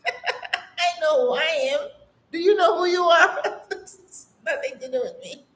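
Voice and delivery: laughing through the words; real laughter breaking through. Real, not acted. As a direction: chuckle it off, amused and unbothered. Laughing at gossipers' opinions.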